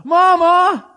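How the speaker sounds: put-on high, loud whiny kid calling for mom; a little kid tattling from the next room; high pitched child complaining to Mama. Hear this voice come out.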